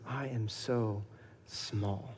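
awed hush. Acted. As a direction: let the words shrink away in awe. Awed realization of smallness.

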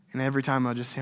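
ordinary talk, nothing marked

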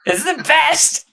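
high, loud burst of delight; gleeful gushing. Real, not acted. Host gushing over a favorite saint, gleeful. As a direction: squeal it with relish.

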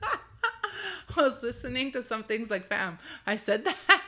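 slow words broken by real laughter; laughing. Real, not acted. Podcaster hearing her old episode, laughing. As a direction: laugh through it, amused at yourself.

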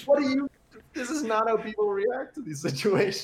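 high, incredulous, half laughing; exasperated disbelief. Retold or imitated. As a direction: squeal it in disbelief. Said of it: "And it's just like".

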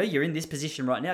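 ordinary talk, nothing marked

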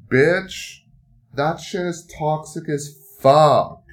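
loud, high, slowed exclamation; shouted disbelief, drawn out; alarmed outburst. Real, not acted. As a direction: blurt it out in real alarm. Recalling toxic cleaner in eye.

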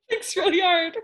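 high, loud words through helpless laughing; real laughter. Real, not acted. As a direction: barely get it out for laughing.

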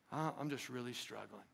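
struggling, hushed. Acted. Struggling member answers, voiced.

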